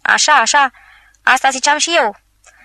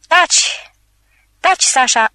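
a raised, alarmed hush, higher on the first Taci; frightened pleading; 5 semitones higher, slower than usual. Acted. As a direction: beg him to stop, scared. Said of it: "îl imploră Slavianov speriat".

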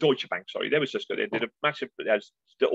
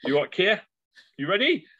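higher, bright mock stage host call; cheery host; 8 semitones higher, 3 dB louder, faster than usual. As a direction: call him up like a cheerful compère. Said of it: "was going like".

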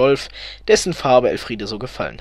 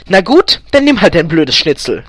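loud, higher, venomous giving-in; spitting venom; 4 semitones higher, 7 dB louder than usual. A narrator's reading. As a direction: give in angrily, spitting it out. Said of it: "geiferte Elfriede".

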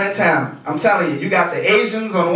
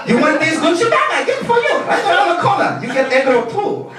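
street vendor voice; 12 semitones higher than usual. Retold or imitated. Imitating Chinatown street seller.

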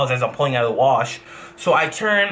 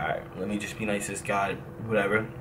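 inner thought; 5 semitones lower, 9 dB quieter than usual. Retold or imitated. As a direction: lower and much softer, thinking to himself. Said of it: "I'm thinking in my back of my mind".

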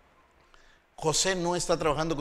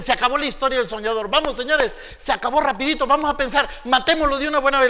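loud, fast and fierce; rally the others to kill him; scheming brothers; 10 semitones higher, 10 dB louder, faster than usual. Acted. Brothers plotting against Joseph, fierce and loud.

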